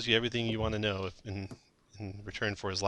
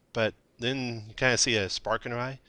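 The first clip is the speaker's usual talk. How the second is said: usual speaking voice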